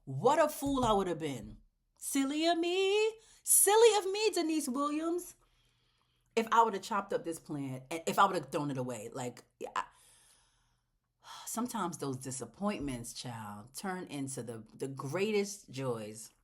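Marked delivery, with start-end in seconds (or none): 2.0-5.3 high, louder teasing scold of herself; mock self-scolding. Chiding herself over the plant, high mock scold. 11.4-16.3 tender, moved. Real, not acted. Telling viewers disappointments become joys, soft and moved. Emotional lesson from plant.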